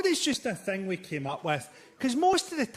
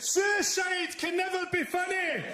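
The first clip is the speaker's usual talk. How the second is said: high, slow mock yell; hammed-up indignant parting shot; parting shout; 12 semitones higher, slower than usual. Imitating the heckler shouting on his way out. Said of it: "he turned around and went".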